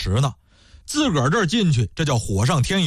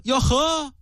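mocking scoff; 10 semitones higher than usual. A narrator's reading. Wife mocking scoffing exclamation.

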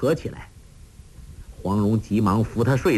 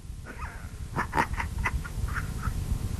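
soft, breathy wheezing chuckle; weak old man's laugh; 10 dB quieter than usual. A narrator's reading. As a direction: laugh feebly on escaping breath. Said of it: "洪七公睁眼笑道". (虚弱的气声笑)

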